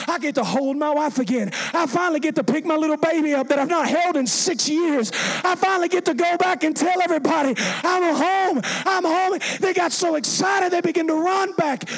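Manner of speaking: joyful preacher's build to a high cry; acted overjoyed homecoming, rising; building excitement. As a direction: build from eager to a high, elated call. Healed lepers' joy building, acted.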